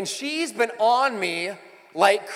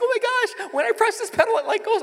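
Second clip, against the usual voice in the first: put-on much higher, thrilled teen girl voice; excited daughter. Dad imitating his daughter at the wheel, high and thrilled. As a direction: squeal it like a kid discovering the gas pedal. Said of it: "she's like".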